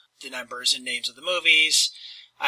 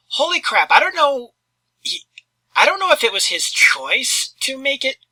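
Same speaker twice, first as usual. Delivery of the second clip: incredulous; 10 semitones higher, 4 dB louder than usual. Real, not acted. Incredulous outburst.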